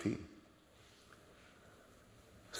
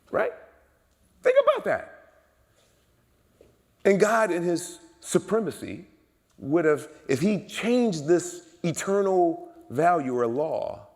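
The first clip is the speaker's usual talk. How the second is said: high, loud pulpit delivery; impassioned preaching; 11 semitones higher, 8 dB louder, slower than usual. Real, not acted. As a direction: punch it out to the congregation, pitched right up. Preaches at full force.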